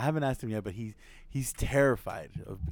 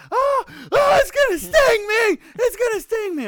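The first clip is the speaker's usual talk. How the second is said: very high, loud panicked shriek; imitated screaming; 12 dB louder than usual. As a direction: shriek like the terrified little brother. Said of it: "we just start hearing screaming".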